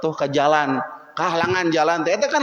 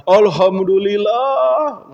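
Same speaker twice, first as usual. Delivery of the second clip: loud, high, drawn-out cry of thanks; mock relief; 6 semitones higher, 5 dB louder, much slower than usual. New father hearing his wife delivered safely, overjoyed. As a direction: act out a husband's jubilant relief.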